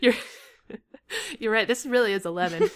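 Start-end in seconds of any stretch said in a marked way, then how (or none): none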